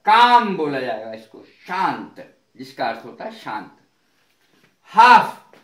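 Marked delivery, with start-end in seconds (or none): 0.1-0.6 काम with a long open vowel, no L; silent L. Shown as a demonstration. Teacher modelling calm for students, काम with silent L. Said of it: "एल नहीं बोला जाएगा". 4.9-5.6 हाफ loud and higher, no L before F; silent L. Shown as a demonstration. Teacher presenting the word half, loud हाफ without L. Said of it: "एल silent रहेगा".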